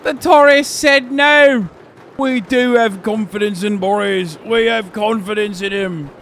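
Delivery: loud, high hammed-up celebration; mock cheering. Streamer voicing Tories after the confidence vote, mock triumphant yelling. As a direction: crow it out at the top of the voice.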